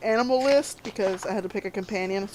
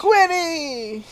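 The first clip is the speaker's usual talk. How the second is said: long, higher-pitched name call; calling the cat; 6 semitones higher than usual. Acted. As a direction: sing out the cat's name.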